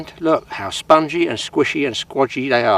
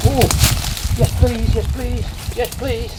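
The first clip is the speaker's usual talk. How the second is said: high, breathless yes please; excited pleading; 9 semitones higher, slower than usual. Real, not acted. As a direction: urge the fish on, thrilled. Fish takes the bait.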